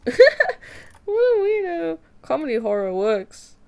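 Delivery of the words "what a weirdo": high, loud, slow laugh-talk. Real, not acted. Artist laughing at her odd-looking drawing. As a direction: giggle through the words.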